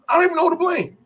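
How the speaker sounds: exasperated, pitch jumping way up; exasperated outburst. Real, not acted. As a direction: throw it out loud and high in disbelief. Repeats it in exasperation at blown play.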